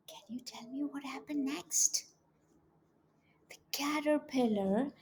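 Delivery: hushed, quick questioning whisper; soft whisper building suspense. Acted. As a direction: whisper to draw the children in. Teasing the children about what comes next.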